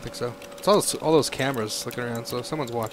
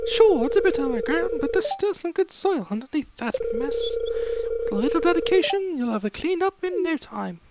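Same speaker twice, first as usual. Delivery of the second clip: very high British carpenter voice; British accent. Acted. Carpenter on the overgrown farm's good soil, high British accent. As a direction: reassure in a high put-on British voice. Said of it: "I don't know why I'm British".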